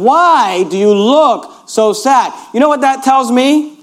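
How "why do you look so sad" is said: slow, even reading of the question; slow plain paraphrase. Shown as a demonstration. Pastor explaining the verse, slow and even. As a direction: draw the question out slowly. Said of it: "He's saying".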